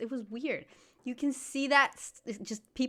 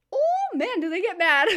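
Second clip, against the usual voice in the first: excited exclamation; 9 semitones higher, 7 dB louder than usual. Real, not acted. Telling how locals get angry.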